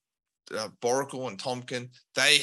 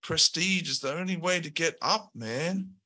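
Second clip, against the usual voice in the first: slacker henchman; 6 semitones higher than usual. Acted. Henchman shrugging off blame to Al, higher and casual. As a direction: play a lazy lackey who just follows orders. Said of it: "The early adopter ... says".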